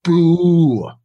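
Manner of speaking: loud playful boo; mock boo. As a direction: boo them like a crowd. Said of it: "booing bad pitchers".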